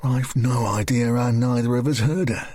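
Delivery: usual speaking voice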